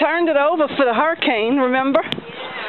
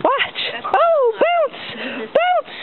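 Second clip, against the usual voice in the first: much higher playful call; excited high voice; 13 semitones higher, much slower than usual. Real, not acted. Mom bouncing for kids.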